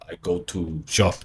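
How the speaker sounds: lightly acted Moldovan accent, word by word; heavy Moldovan accent. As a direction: imitate a Moldovan man's halting English. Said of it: "you have a heavy Moldovan man".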